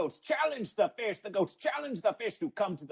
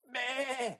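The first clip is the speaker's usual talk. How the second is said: goat bleat; 7 semitones higher, 4 dB quieter than usual. Acted.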